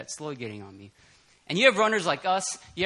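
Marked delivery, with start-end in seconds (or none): 0.0-0.9 quiet lower repeat. Shown as a demonstration. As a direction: say it again under your breath, lower. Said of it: "I'll say that".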